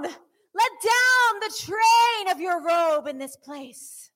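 slow, high shouted cry; shouted worship plea. Real, not acted. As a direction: cry it out to heaven. Crying out in worship.

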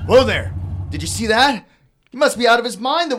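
loud, high startled cry; startled shout. Acted. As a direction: jump back and shout it. Startled by car peeling in.

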